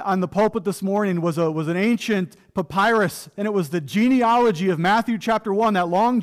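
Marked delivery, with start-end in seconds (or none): none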